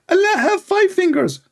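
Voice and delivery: high mocking preacher voice; squeaky sermon mimicking a preacher; Ali Dawa. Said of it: "Ali Dawa".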